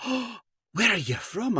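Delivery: put-on high, slow Scottish old-country lilt; delighted mock-Scottish question; Scottish mother. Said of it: "a four-foot-tall Scottish lady".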